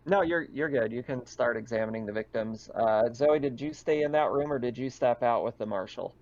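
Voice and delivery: usual speaking voice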